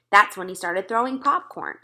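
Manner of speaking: ordinary delivery, nothing marked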